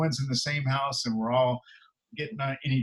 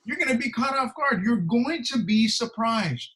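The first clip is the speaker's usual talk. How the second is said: higher, urgent warning; 6 semitones higher than usual. Retold or imitated. Solomon warning the lazy man, higher urgent. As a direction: press the warning home. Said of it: "And he says".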